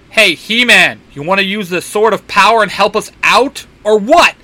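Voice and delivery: loud, high, irritated snap; snapping. Acted. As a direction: bark it at him impatiently. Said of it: "Simon snaps".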